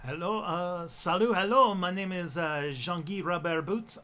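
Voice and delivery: French-Canadian English, halting; thick Quebecois French accent. Shown as a demonstration. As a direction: play a man from northern Quebec. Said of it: "do your Quebecois accent".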